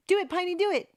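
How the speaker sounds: high, loud playful urging; egging on. Real, not acted. Streamer urging Piney to do it, egging on. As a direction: goad them on with rising energy.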